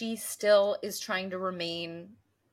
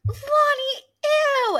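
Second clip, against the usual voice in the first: disgusted shriek; 9 dB louder, much slower than usual. Retold or imitated. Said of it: "she was like".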